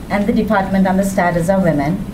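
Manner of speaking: usual speaking voice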